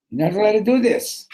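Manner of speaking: high, loud objection; insistent protest. Acted. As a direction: forbid it urgently. Newcomer repeats his protest.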